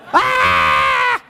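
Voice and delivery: high loud playful scream; 开玩笑式的尖叫; mock scream. Car swerving into a ditch.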